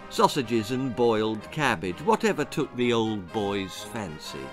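ordinary delivery

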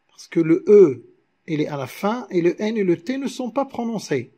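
ordinary talk, nothing marked

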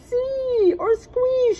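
put-on high, light girl voice; high childish shrug; little sister voice. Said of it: "she said with a shrug".

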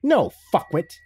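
high, scornful exclamation; scornful corpse. A narrator's reading. Corpse mocks the captive beside it, scornful. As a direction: throw the insult up high.

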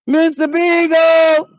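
slow, loud, high call for the dog; drawn-out call. Acted. Owner calling her lost dog through the woods, slow drawn-out shout. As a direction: stretch the name out like calling far away.

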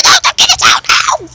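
high, panicky shouted protest; play panic. Pretend. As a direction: cry out in mock alarm. Acted panic in puppet play.

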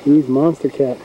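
ordinary talk, nothing marked

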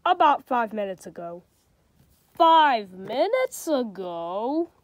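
storybook reading with light character voices; reading to children. A narrator's reading. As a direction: read it like a bedtime picture book. Reads a picture book aloud.

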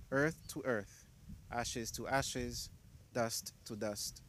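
slow, soft committal words; hushed graveside prayer. Acted. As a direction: let each phrase sink slowly and softly. Graveside committal of brother Earl.

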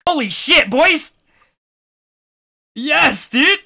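elated. Real, not acted. Winning the stake.

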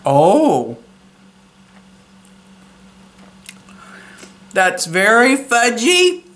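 speaking around food; talking while chewing. Real, not acted. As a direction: mouth partly full. Seen 1: Bite taken at -5; mouth still working food while speaking.

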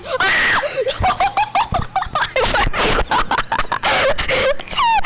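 loud, high laughing fit; loud squealing cackles; shrieking laughter. Real, not acted. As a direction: howl with high, helpless laughter.

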